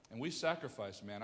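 ordinary talk, nothing marked

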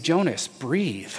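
slow, soft and soothing; calm a friend down, slowly. Retold or imitated. Friend soothing Jonas, slow soft calming voice. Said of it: "And he said".

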